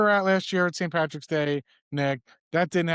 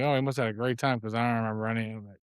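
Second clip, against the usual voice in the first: quick mock quip; 7 semitones lower, 5 dB quieter, faster than usual. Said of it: "And I was like".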